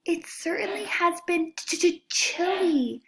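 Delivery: high, slow, teeth-chattering; shivering. Acted. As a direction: stammer through the cold. Possum stutters from cold.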